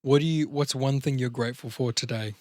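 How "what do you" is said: ordinary delivery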